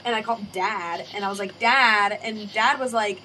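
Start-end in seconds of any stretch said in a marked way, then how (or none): 1.6-2.2 loud call of Dad's name. Retold or imitated. Daughter calls Dad loudly on the phone, raised voice. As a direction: call Dad out loud to get his attention.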